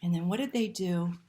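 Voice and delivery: plain reading voice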